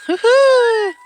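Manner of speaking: loud, high whoop; excited cheer. Real, not acted. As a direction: cheer as she flies. Pushing child on swing.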